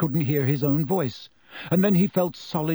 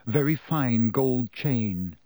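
ordinary delivery, nothing marked

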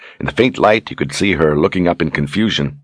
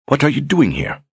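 higher, demanding woman's voice; sharp older sister; 10 semitones higher than usual. Acted. Sister catching Brogan where he shouldn't be, demanding. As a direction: challenge the intruder in a raised voice.

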